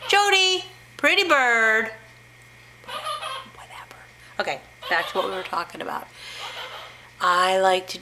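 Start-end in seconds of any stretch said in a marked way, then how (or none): 0.1-1.9 high singsong bird call; higher pet-talk voice; coaxing a parrot. Real, not acted. 2.9-4.0 very soft, drawn-out whisper; hushed throwaway aside; dismissive whisper. Real, not acted. Giving up on the bird.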